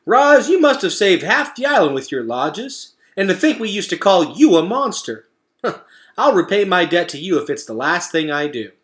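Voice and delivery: hearty, laughing gratitude; Digdown, grateful. Acted. Groundhog speaks to Roz.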